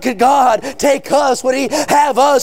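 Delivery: driving, sustained revival sermon cadence; full-force preaching. Real, not acted. Preacher proclaiming salvation to the congregation, full-force preaching. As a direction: preach it hard, stretching the key words.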